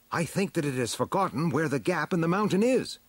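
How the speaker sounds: high-pitched hero voice; storybook hero. A narrator's reading. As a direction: voice the hero above the narration. He-Man's line in story dialogue.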